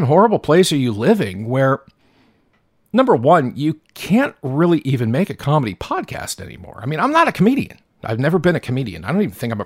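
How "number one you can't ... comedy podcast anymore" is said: normal talking voice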